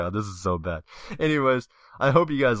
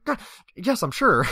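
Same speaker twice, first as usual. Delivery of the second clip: yelped high ah yes, then louder; flustered yes; 10 semitones higher, 4 dB louder than usual. Retold or imitated. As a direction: caught out, covering too fast.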